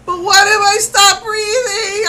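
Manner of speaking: high, loud, slow, tearful panic; panicked plea. Retold or imitated. To the MRI caller on the phone, panicking and near tears. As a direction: voice cracking with fear of the sedation. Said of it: "I'm flipping".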